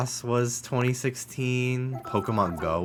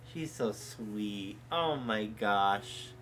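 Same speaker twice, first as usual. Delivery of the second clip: soft gushing; 7 dB quieter, much slower than usual. Real, not acted. Gushing over the dog.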